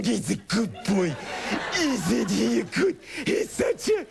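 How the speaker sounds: high, slow laughing coo; baby talk to puppy. Retold or imitated. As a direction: sing it sweetly to the dog. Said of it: "I'm going".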